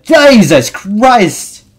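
high, loud, drawn-out exclamation; startled outburst. Real, not acted. Gamer startled mid-run, loud stretched outcry. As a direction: cry out slowly in shock.

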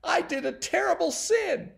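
put-on very high, loud, distraught child; acted sobbing wail. A narrator's reading. Timmy afraid of God after stealing, mock sobbing. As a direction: wail it out as a frightened, guilty boy. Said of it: "He sobbed and he sobbed".

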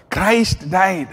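ordinary talk, nothing marked

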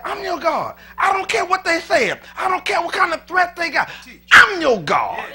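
loud, high preaching as God speaking; rising preacher's shout; full-force preaching. Acted. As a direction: preach it hard, God commanding the flock. Preaching God's words again.

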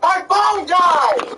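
high, slow defensive shout; drawn-out defensive yell. Real, not acted. Defensive excuse in an argument.

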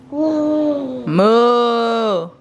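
a hint of a mooing cow. Shown as a demonstration. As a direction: moo for the toddler. Mother models cow sound.

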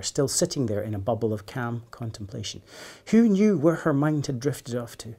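plain reading voice

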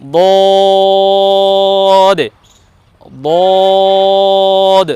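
বদ with very long level o; drawn-out daad. Shown as a demonstration. Teacher models the dotted letter, long held বদ. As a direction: hold the vowel of বদ on one note, closing on d. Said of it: "তাহলে এর উচ্চারণ হবে".

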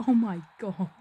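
a burst of real excitement at the big chance; loud excited oh my god. Real, not acted. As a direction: cheer it at the screen. Reacting to a goal chance.

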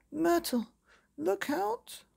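very high mother's scream of warning. Acted. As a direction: warn her daughter of the bus. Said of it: "screamed Mother".

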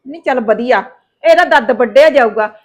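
ordinary talk, nothing marked

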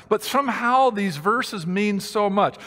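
ordinary delivery

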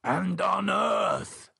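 put-on slow, high old man voice. A narrator's reading. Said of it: "added the old man".

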